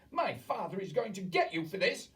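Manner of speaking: put-on high, loud boy's shriek. A narrator's reading. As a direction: shriek it like a spoiled brat. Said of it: "leapt up shrieking".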